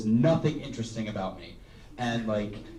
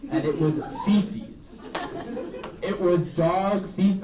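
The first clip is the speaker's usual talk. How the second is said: slow reveal; 7 semitones higher, slower than usual. Real, not acted. Landing the punchline to the crowd, slow emphatic reveal. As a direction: drag out the gross reveal.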